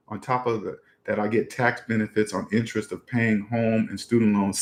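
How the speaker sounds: low, very soft reading to oneself; comment read-out. Real, not acted. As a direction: read it under your breath, low. Reads out viewer's comment.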